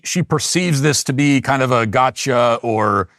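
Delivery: ordinary talk, nothing marked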